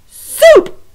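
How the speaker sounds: very loud, very high defiant shout. Acted. Cook defying Louise, very loud high shout. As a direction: scream the forbidden word. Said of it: "shouted Cook".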